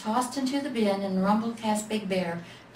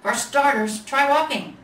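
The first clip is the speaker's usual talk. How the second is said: louder, higher bossy order; bossy big bear; 5 semitones higher, 7 dB louder than usual. A narrator's reading. Big Bear orders the little bear to walk, bossy and loud. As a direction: command it like a gruff boss.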